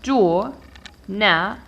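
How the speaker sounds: jour, na, list as three separate beats; divided into syllables. Shown as a demonstration. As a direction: pause after each piece: "jour", "na", "list". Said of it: "I start by dividing".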